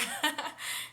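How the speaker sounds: high amused laugh, falling away; burst of laughter. Real, not acted. Vlogger laughing to viewers about Couchsurfing, high laugh. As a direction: laugh out at the memory.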